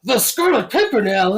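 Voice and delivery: high, loud dramatic disbelief; hammed-up shocked movie hero; mock astonishment. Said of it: "and he's like".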